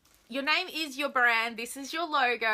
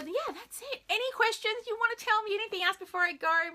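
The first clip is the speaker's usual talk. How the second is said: ordinary talk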